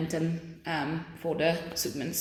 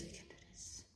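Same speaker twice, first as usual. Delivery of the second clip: whispering to yourself; whispered; 28 dB quieter than usual. Real, not acted. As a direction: whisper it as a private pep talk. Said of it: "Come on, guys, we can do this".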